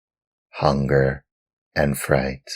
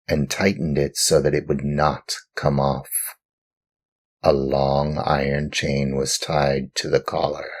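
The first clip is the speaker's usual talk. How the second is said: plain reading voice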